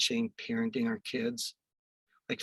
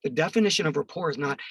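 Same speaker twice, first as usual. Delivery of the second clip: ordinary delivery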